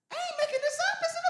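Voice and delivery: very high, loud shout; preaching shout. Real, not acted. Preacher insisting to the congregation, shouting. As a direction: shout it to the back row.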